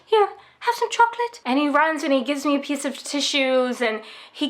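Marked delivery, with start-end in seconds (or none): 0.1-1.4 much higher, flustered voice of a kind bank clerk; high-pitched flustered gentleman; fussing kind banker. Retold or imitated. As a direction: rush in to comfort a crying woman, pitched way up. Banker offering chocolate.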